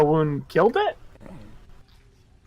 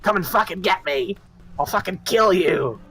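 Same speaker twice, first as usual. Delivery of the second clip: goblin voice; 4 semitones higher than usual. Acted. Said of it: "I'll say in Goblinoid".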